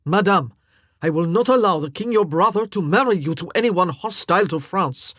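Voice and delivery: loud, forceful royal threat; furious king. A narrator's reading. Furious king confronting the queen, loud. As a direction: lay down the law in fury. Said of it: "came a furious King Francois".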